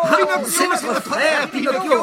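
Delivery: very high, loud excited calls; puppets shouting. A narrator's reading. Puppets hailing their brother, high and loud. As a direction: cheer his name like a crowd. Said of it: "hurlèrent-ils".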